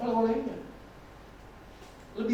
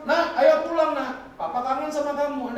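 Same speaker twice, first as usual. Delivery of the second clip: father pleading; 6 semitones higher, 5 dB louder than usual. Retold or imitated. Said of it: "bapaknya telepon".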